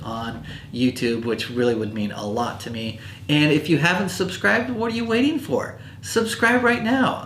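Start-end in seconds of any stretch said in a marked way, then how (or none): 3.3-7.2 urgent high plea. Acted. Urges viewers to subscribe.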